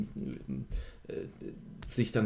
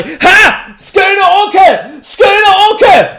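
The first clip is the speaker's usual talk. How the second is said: high piercing mad scream; shrill scream; 22 dB louder, much slower than usual. Acted. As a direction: scream it out at the top of your lungs. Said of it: "mit dem gellenden Schrei".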